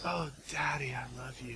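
breathy whisper to God; imitating a friend's whispered prayer. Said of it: "he always says".

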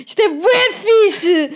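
high, slow, laughing squeal; gushing with delight; excited squeal. Retold or imitated. Retold excitement.

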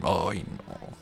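ordinary talk

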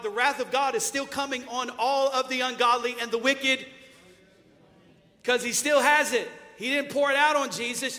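loud, rising preacher's cry; full-force preaching. Acted. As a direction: preach it hard to the congregation.

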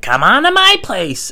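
slow, high and loud, overly eager; sing-song drunk welcome, drawn out high; drunk high invite. Retold or imitated. Inviting stranger home while drunk.

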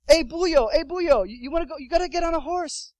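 high, loud hailing shout; pushy horse guide calling out; imitated guide calling. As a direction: holler over to a straggler.

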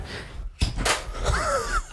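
high breathy burst of laughter; a sudden surprised laugh; startled laugh. Real, not acted. Mic falls off mid-sentence.

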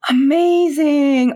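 high, loud, drawn-out burst of delight; gushing, stretched-out exclamation; elated. Real, not acted. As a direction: draw it right out, high and thrilled. Asked how the partnership feels.

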